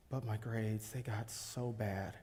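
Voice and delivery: low, hushed admission; quiet regret; subdued confession. Acted. Confessing his troubles at school.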